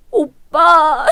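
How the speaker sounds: high crying whimper; a sister crying to her brother; whimpering sob. Acted. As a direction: sob to win the brother over. Sister fakes tears to brother.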